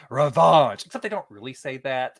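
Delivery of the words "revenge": "revenge" said mock-French as "ruh-VAHNZH", louder; louder mock French "revenge" with a nasal ending; fake French revenge. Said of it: "As the French say".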